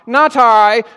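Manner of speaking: loud, high cat refusal, lightly characterized; storybook cat. Acted. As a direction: play the cat, called out high. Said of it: "purred the sleepy cat".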